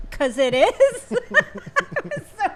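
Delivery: real laughter breaking through the words; laughing. Real, not acted. Telling the funny lookalike story to the co-host, laughing hard. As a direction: crack up loudly while talking, pitch jumping up. Cracking up at her own story.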